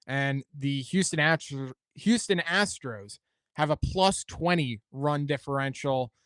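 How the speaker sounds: normal talking voice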